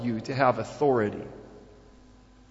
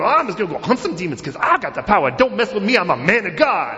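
loud, high mock bravado; hammed-up holy-warrior boasting; boastful demon hunter; 5 semitones higher, 6 dB louder than usual. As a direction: puff yourself up as a swaggering demon hunter.

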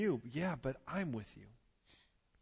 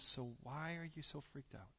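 gentle chiding; 12 dB quieter than usual. Retold or imitated. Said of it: "And he goes".

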